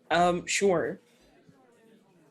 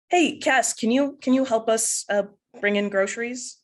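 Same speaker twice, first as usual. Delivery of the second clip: higher, louder voice of a dad; dad calling out; 7 semitones higher, 6 dB louder than usual. Acted. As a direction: call to your kid for a hand. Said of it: "your dad sort of stops you and says".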